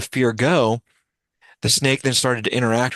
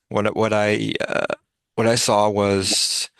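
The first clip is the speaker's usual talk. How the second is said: ordinary delivery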